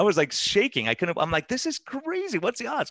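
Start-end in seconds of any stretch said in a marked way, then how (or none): 1.5-2.9 amazed disbelief. Retold or imitated. Shaking with amazement at coincidence.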